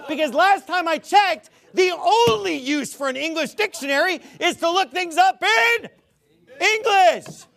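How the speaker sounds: loud, high-pitched rant; exasperated shout. Real, not acted. Preacher rebutting a critic about dictionaries, exasperated shouting. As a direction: shout it, building to the last word.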